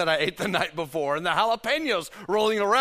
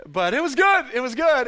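louder, relishing every word; emphatic savoring; 5 semitones higher, 6 dB louder than usual. Real, not acted. Pastor tells congregation the burger was good, loud and relishing. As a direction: insist it really was good.

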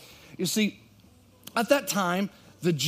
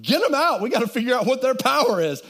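much higher, loud, urgent command; king shouting orders; 7 semitones higher, 7 dB louder, faster than usual. Retold or imitated. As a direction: shout to the guards to pull them out. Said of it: "And he said".

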